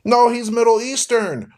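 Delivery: high, loud imitated retort; mock objection. Mimicking someone arguing about where Jesus is from, insistent.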